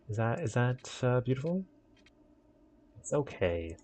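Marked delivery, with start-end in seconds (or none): 3.0-3.7 lower, softer "okay"; low self-reassuring okay; low reassurance. Pretend. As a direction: settle it with a low murmur. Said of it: "repeated reassurance".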